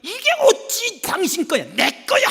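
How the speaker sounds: high, indignant claim; mother butting in to grab the toy; wife arguing with husband over a toy, high and indignant; squabbling mother. Retold or imitated. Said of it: "엄마가 또 끼어들어가더니".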